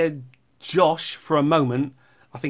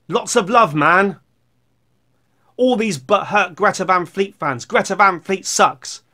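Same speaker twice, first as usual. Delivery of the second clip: loud, higher-pitched mock rant; membacakan komentar pedas; 4 semitones higher, 6 dB louder than usual. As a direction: declaim the hostile comment loudly. Reads a hostile comment aloud.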